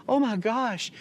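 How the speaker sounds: high-pitched, thrilled gasp of words; retold amazement. Family receiving a free ticket, amazed. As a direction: imitate an overjoyed recipient.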